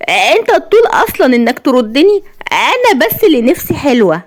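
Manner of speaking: high, haughty woman's voice; vain and confident. A narrator's reading. Woman puts her suitor down, arrogant confidence. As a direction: toss it out high and conceited. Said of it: "قالت بغرور وثقة".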